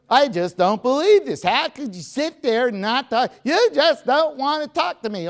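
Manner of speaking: high, loud mock rant of an upset wife; a wife berating her silent husband, shrill and loud in mock fury; pissy wife rant. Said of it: "And you get all pissy".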